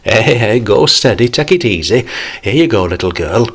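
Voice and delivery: put-on calm the jostling kids warmly; good-humoured uncle; jovial uncle. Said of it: "avuncular protector".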